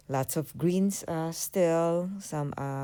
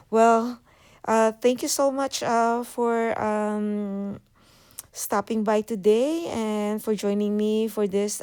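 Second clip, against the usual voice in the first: excited; 8 semitones higher, 5 dB louder, slower than usual. Real, not acted. Cheerful sign-off to viewers.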